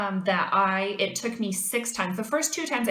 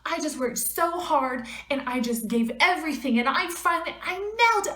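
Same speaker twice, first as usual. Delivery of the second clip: much higher, pouring it all out to mom; upset excited rant; 6 semitones higher, 4 dB louder than usual. Retold or imitated. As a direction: gush it in a rush, hurt and proud. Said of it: "I called my mother and I said".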